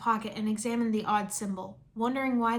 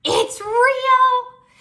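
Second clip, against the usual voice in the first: thrilled; 14 semitones higher, 13 dB louder than usual. Acted. Jumping around happily.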